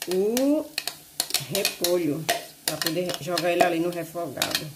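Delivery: usual speaking voice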